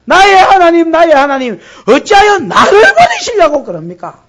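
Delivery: loud, high crying out to God; anguished cry. Acted. Jesus on the cross crying to God, loud anguish. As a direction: cry out as one forsaken.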